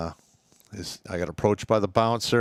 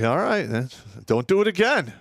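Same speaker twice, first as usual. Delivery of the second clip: scolding sergeant; 8 semitones higher, 4 dB louder than usual. Retold or imitated. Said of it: "And I'd be like".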